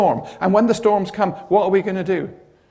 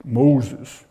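lower, deep calling voice; voice of God; 5 semitones lower than usual. Retold or imitated. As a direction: call his name gravely from the bush.

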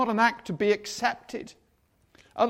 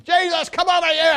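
high, loud call across a crowd; shouting; 9 semitones higher, 10 dB louder than usual. Acted. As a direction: yell out for help from afar. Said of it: "You remember him shouting".

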